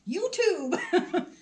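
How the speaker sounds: high, loud playful YouTube; excited. Real, not acted. Joking jab at YouTube.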